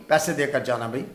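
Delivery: ordinary delivery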